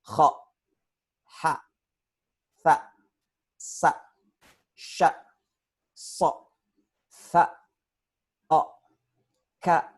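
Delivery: isolated Arabic letters, each cut short; articulation drill. Shown as a demonstration. Ustadz demonstrating makharijul huruf to adult learners, crisp letter-by-letter drill. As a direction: sound out each letter separately with precise articulation. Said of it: "makharijul huruf, belajar".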